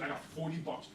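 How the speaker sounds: ordinary talk, nothing marked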